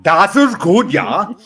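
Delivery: loud hammed-up German accent; playful loud German accent; mock German. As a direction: bark it like a comic German. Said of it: "really thick German accent".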